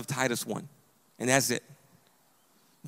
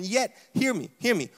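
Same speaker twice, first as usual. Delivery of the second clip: ordinary talk, nothing marked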